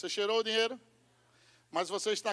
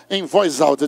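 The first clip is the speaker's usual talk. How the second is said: loud; 6 semitones lower, 12 dB louder than usual. Shown as a demonstration. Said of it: "Diga, voz alta".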